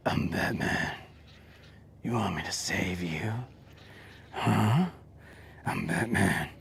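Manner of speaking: low, soft, breathy Batman whisper; whispery Batman voice. Retold or imitated. Mocking Batman's whisper to the viewers. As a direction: hiss it out like a gravel-throated caped hero. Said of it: "the weirdest Batman voice".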